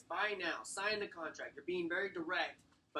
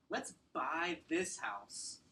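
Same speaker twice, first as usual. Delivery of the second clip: let's buy this house, said very slowly; slow emphatic ask; much slower than usual. Shown as a demonstration. Realtor closing the buyer, slow emphatic ask. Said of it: "putting the emphasis on the ask".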